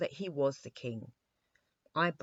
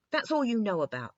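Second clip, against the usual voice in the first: cutting in, higher and louder; a rude little interruption; impolite interruption; 6 semitones higher, 8 dB louder, faster than usual. A narrator's reading. King cuts off Eyebright, rudely. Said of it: "interrupted the king, somewhat impolitely".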